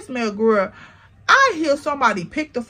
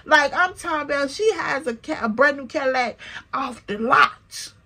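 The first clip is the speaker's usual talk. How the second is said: usual speaking voice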